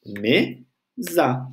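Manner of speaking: "Mesa" split in two, me, za; slow, detached syllables on "Mesa". Shown as a demonstration. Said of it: "Vou separar ela em sílabas".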